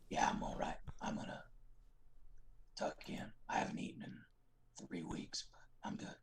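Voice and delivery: hoarse whispered Daryl impression. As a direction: rasp it out under your breath. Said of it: "the Daryl thing".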